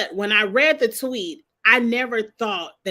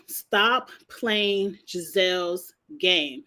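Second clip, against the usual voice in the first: ordinary delivery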